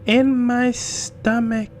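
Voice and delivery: put-on very high child voice, slow and loud; creepy, drawn-out little-girl reveal; cunning little girl. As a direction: draw it out with a sly grin. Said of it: "with a cunning grin and said".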